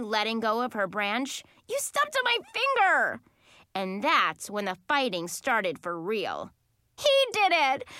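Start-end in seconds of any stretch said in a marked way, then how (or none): 1.7-3.2 very high pained accusation; shrieking. A narrator's reading. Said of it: "Lauren shrieked at Joey". 7.0-7.9 high, loud accusing yell. A narrator's reading. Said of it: "Brittany yelled".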